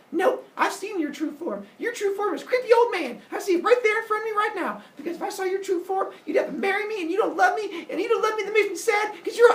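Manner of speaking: high-pitched, mounting mock rant; yelling and going off. Semele demands marriage and love from Zeus, yelling. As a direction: build into a loud, high tirade. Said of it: "she just starts yelling at him and going off".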